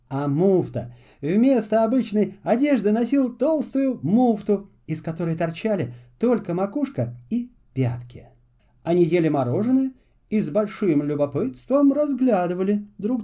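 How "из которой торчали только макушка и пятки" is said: ordinary delivery, nothing marked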